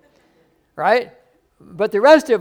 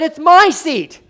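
higher, louder, possessive bark; indignant usher; 10 semitones higher, 9 dB louder, slower than usual. Retold or imitated. Usher loudly insisting to the visitor, indignant. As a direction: stake your claim on the seat.

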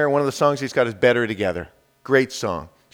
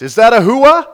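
raised, loud call to the crowd; loud rallying question; 7 semitones higher, 11 dB louder than usual. Shown as a demonstration. Preacher asks congregation for a hua, loud call. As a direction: call out for their response. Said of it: "little more power behind that".

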